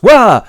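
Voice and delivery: loud, high cry of shock; mock startled yelp. Willie startled by the woman from his past, yelping. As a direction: yelp as if caught out. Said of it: "And Willie goes".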